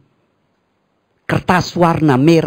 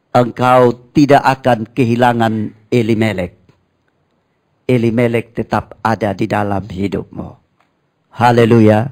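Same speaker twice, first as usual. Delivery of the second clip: ordinary talk, nothing marked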